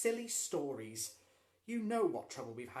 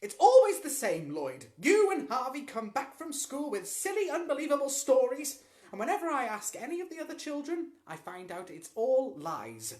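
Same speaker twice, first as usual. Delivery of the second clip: loud, much higher furious telling-off; angry scolding; 10 semitones higher, 15 dB louder than usual. A narrator's reading. Mother losing her temper at her son's stories, loud scolding. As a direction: scold the boy hard. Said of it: "Missus Hunter was very angry".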